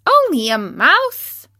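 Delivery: high, loud, affronted little mouse; indignant squeak. A narrator's reading. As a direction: squeak it slowly as an outraged question. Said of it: "cried the little animal indignantly".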